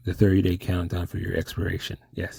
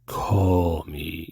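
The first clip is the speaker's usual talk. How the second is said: Mystery Man voice; 2 semitones lower, much slower than usual. Retold or imitated. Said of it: "I can't do Mystery Man's voice".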